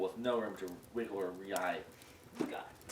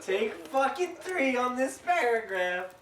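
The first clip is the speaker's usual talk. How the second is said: high, loud, slow exasperated yell; exasperated shout; 10 dB louder, slower than usual. Real, not acted. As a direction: shout the take number in frustration. Exasperated announcement after retakes.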